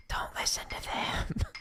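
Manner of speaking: hushed, slow whispered aside. Acted. To viewers about bad tutorials, a slow conspiratorial whisper. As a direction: lean in and whisper the warning to viewers.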